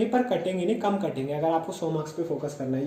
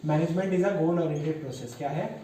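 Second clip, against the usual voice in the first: ordinary talk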